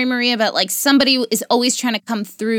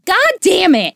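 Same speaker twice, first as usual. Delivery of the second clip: exasperated outburst; 9 semitones higher, 4 dB louder than usual. Retold or imitated. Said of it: "I go".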